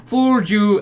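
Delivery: high-pitched mock gloating call; imitated triumphant shout; retold shout. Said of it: "and is shouting".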